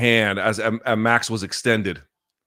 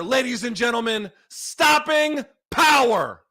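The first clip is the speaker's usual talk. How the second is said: much higher and louder, drawn-out yells; ecstatic screaming; 4 dB louder, slower than usual. Real, not acted. Streamer screaming as his fighter wins by knockout. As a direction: lose it completely as the fight ends. Live reaction to a KO finish.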